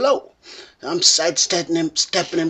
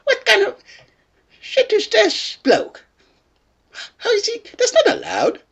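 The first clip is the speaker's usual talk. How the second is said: indignant disbelief; 13 semitones higher than usual. Retold or imitated. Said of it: "It's like".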